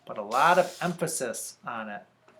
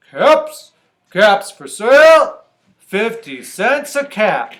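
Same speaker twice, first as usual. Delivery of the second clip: loud, raised hawker's cry; street seller's call; 8 semitones higher, 16 dB louder, slower than usual. Acted. Peddler calls caps for sale in town, loud cry. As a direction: call out wares, slowing on the price. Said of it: "he walked back to town calling".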